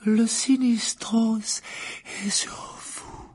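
high then breathed to nothing, slow; ominous seer. Acted. The divination teacher tells Harry the Grim is on him, ominous. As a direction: announce doom, fading to a breath.

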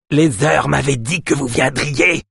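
slow, high bleating voice. A narrator's reading. Little man Yosp to his rescuers, bleating. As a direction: bleat it out slowly like a goat. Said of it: "bêla Yosp".

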